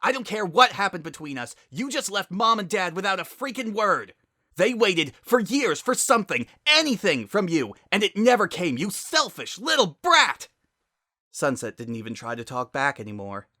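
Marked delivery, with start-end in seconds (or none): 0.0-10.5 high, loud screaming rant; furious shout. Acted. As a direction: tear into her with rage.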